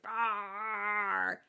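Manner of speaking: drawn-out mock dragon roar; playful imitated monster growl. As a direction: roar like a charging storybook dragon. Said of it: "the dragon's coming at him like".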